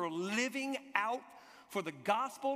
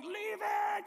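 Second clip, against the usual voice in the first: high-pitched mock scream; crowd screaming; 13 semitones higher than usual. Crowd in the tunnel to the jacket seeker, screaming. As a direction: imitate a crowd hollering way up high. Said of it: "everybody in the tunnel now screams at me".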